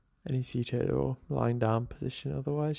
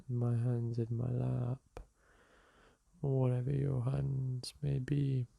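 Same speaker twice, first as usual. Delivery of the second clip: calm, softer meditation guide voice; guided meditation; 3 dB quieter, slower than usual. Acted. Teacher guiding listeners through a seated meditation, calm and soft. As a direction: ease the listener into the breath.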